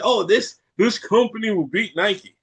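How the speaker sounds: mock-confident pronouncement; confident professor. Imitating a professor predicting Nike's defeat, mock confidence. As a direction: declare it like a self-assured old professor. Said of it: "said it with the confidence".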